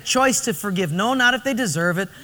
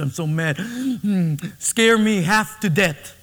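scheming husband; slower than usual. Retold or imitated. Punchline of genie joke.

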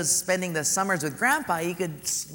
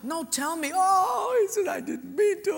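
put-on high, loud crying child; sobbing boy; 13 semitones higher, 3 dB louder than usual. Boy confessing to grandpa, high sobbing. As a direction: blubber the confession.

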